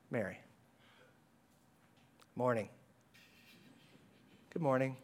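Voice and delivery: soft, low calm greeting; understated. Retold or imitated. Risen Jesus greeting Mary softly, calm and understated. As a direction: gently say good morning, unbothered. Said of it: "show up and say".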